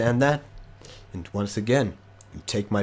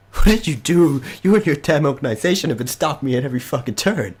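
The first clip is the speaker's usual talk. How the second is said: higher and louder, a fast furious rant; furious villain shout; 5 semitones higher, 6 dB louder, faster than usual. Acted. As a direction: yell the grievance at them. Villain confronts players angrily.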